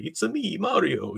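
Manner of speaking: high-pitched cartoon Italian accent; Mario's Italian accent. Showing viewers what voice the character has always had, Italian accent. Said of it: "he's got that accent".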